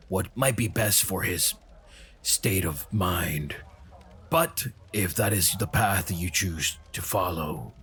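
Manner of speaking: low, slow, measured in-character voice; solemn blessing. Acted. Speaking to Dahlia about the boy, solemn and low. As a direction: pronounce a grave blessing, low and unhurried.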